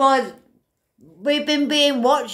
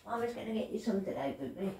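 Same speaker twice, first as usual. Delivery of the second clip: hushed and quick, cooing to the cat; quiet pet talk, much softer; 2 semitones lower, 16 dB quieter, faster than usual. Real, not acted. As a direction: murmur to your pet while busy. Talking softly to her cat.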